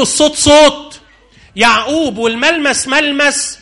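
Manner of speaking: high, loud, then slow and wondering; puzzled old father. Acted. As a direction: puzzle it out slowly.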